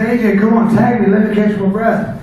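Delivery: exhausted wrestler shouts to his partner for a tag; pleading call. Retold or imitated. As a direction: beg loudly for a tag.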